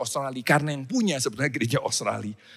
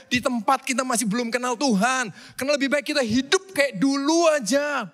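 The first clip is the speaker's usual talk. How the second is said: mock whining; 9 semitones higher than usual. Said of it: "Mereka nangis gitu".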